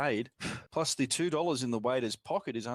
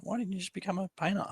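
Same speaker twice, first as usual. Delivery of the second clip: imitating a girl; 7 semitones higher, faster than usual. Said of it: "she's like".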